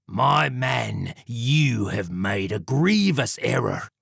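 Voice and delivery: slow, smug villain warning; menacing Jack. Acted. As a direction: warn him coolly and slowly. Villain Jack voiced in story.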